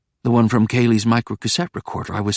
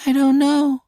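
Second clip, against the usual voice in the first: put-on higher, little boy's voice; small child. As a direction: sound like a lost toddler. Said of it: "My voice, high-pitched".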